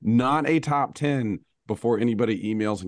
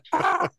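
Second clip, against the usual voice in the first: high mock scream of the wife staring him down; imitated scream. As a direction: scream it in a sharp high burst. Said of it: "screamed ... just going".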